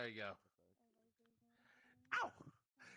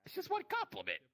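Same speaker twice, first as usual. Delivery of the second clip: much higher, louder squeak; high squeaky voice; 6 dB louder than usual. Pretend.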